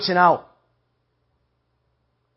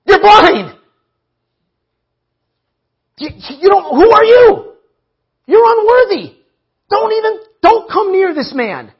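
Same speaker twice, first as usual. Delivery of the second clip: very high, loud scolding shouts; angry crowd; 12 semitones higher, 5 dB louder than usual. Acted. Crowd tells the blind beggar to shut up, scolding. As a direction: shout him down in a high, outraged voice. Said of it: "And everybody's like".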